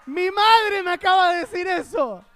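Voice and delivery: loud, high-pitched disbelieving cry; shocked outburst. Real, not acted. Comic reacting to mother supplying a rude translation, shocked outburst.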